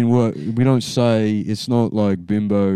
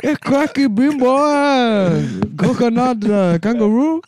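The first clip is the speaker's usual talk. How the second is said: very high mock African-Australian accent, near laughter; hammed-up, high-pitched Australian African accent; 12 semitones higher than usual. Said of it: "Australian African".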